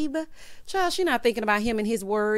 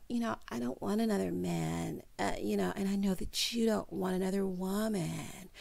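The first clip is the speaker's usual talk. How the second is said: Kim K voice; 9 dB quieter than usual. Retold or imitated. Wife insisting she wants no other man, mock Kim K voice. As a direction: imitate her quietly, much softer than your own. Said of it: "crying and lying with her Kim K voice".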